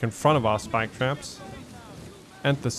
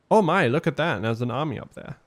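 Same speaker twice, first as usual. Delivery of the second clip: ordinary talk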